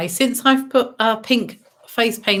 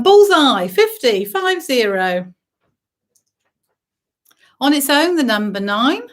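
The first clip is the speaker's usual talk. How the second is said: bingo caller. Acted. Calls bingo numbers.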